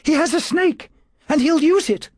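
very high urgent warning; alarmed cry. A narrator's reading. Woman warns the attendants of danger, high alarmed cry. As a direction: cry out a warning, pitched right up. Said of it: "Julia cried".

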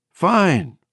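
high, wavering, exhausted voice; Bobby. A narrator's reading. Exhausted woman reassuring her shocked friend, high and wavering. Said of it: "hear the waver in her voice again".

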